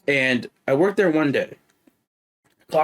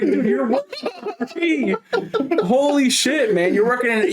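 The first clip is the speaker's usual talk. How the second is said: laughing disbelief; 9 semitones higher than usual. Retold or imitated. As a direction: chuckle while you say it, amazed. Still laughing in the retold reply.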